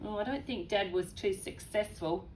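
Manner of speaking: usual speaking voice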